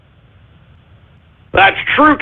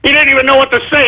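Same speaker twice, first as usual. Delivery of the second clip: usual speaking voice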